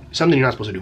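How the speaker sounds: ordinary talk, nothing marked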